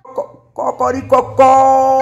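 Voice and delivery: drawn-out high rooster crow. Retold or imitated. Woman imitating how the rooster sings, rooster crow. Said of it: "o galo canta assim".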